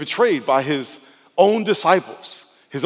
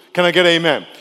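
normal talking voice

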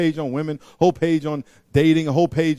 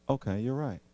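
much softer, calm giving in; calm concession; 5 semitones lower, 10 dB quieter than usual. Acted. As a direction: defuse the argument gently. Acted calm reply.

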